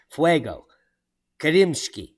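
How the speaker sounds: ordinary talk, nothing marked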